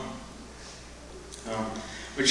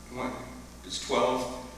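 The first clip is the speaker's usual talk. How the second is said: normal talking voice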